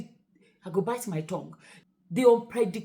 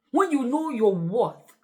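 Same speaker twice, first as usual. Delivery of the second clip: ordinary talk, nothing marked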